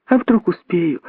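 put-on high, eager child's voice; keen high-pitched youngster; exclaiming little monkey. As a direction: burst out hopefully in a small high voice. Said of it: "воскликнула мартышка-дочка".

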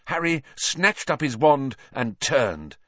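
usual speaking voice

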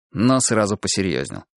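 usual speaking voice

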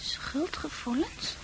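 slow, soft whisper. Acted. Fran to the doctor, whispering in disbelief. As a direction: breathe it out stunned.

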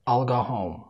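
"I'll go home" quick and contracted; hurried speed. Shown as a demonstration. Teacher shows learners the hurried speed, contracted. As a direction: squeeze I will into "I'll", keeping the L. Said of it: "وبعد كده المستعجلة".

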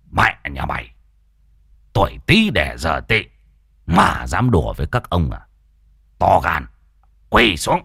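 low, menacing command; low-pitched icy authority; cold stern master. A narrator's reading. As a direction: threaten coldly like a grand general. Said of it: "khuôn mặt cũng lạnh lùng".

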